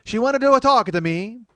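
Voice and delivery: loud, high cartoon Italian; mock Italian accent. Host naming performer from Italy, mock Italian accent.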